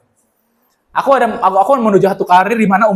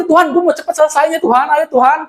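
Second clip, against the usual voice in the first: impatient pleading; 6 semitones higher than usual. Retold or imitated. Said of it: "Kadang ketika kita khawatir".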